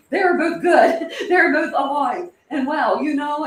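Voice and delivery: real tears while you laugh at yourself; laughing through tears. Real, not acted. Streamer choked up telling chat the dog is alive, laughing through tears. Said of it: "I'm gonna cry, guys".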